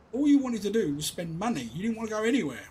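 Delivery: fed-up dad scolding; exasperated. Real, not acted. Angry dad rant.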